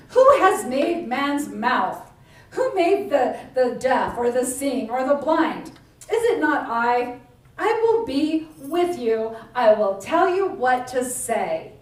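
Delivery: God firmly putting Moses in his place; commanding God. Acted. God rebukes Moses's excuse.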